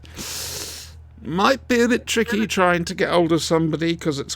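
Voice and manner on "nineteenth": whispered. Real, not acted. Conceding the date.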